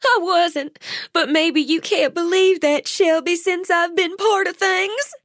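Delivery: high, tearful and worn out; weeping. A narrator's reading. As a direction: cry it out, exhausted. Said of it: "began to weep".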